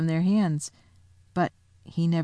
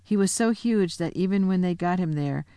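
plain reading voice

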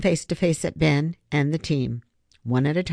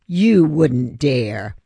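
very slow and louder cold threat; menacing dare; 6 dB louder, much slower than usual. Acted. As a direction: drag each word out as a warning. Griffin glares, twin lasers.

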